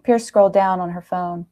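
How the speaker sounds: plain reading voice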